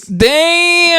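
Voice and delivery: high stunned exclamation. Real, not acted. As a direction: gasp it out in disbelief. Reacting to hot take.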